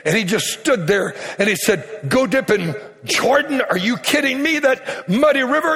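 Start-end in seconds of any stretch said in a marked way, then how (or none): 2.1-5.8 higher-pitched, incredulous indignation; outraged. Acted. Naaman balks at dipping in the Jordan, outraged. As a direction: play mildly outraged disbelief at an insulting order. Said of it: "he was incensed and outraged".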